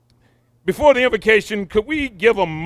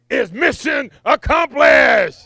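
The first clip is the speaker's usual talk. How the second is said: triumphant; 7 semitones higher, 4 dB louder, slower than usual. Acted. Triumphant announcement to the crowd.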